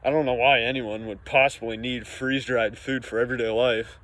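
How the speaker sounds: normal talking voice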